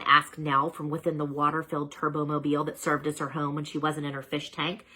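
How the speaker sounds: ordinary delivery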